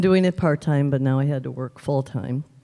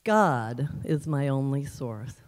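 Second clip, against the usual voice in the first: "God" drawn out, then the rest falls away; stress on God; 2 semitones lower, 3 dB quieter, slower than usual. Shown as a demonstration. Showing how to change the inflection, stress on "God". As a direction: lean on "God" and pause slightly after it. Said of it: "change the inflection on the word".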